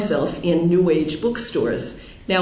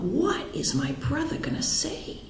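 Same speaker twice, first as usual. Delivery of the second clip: anxious inner thought; 8 semitones higher, 8 dB quieter than usual. Retold or imitated. Dreading her brother's answer.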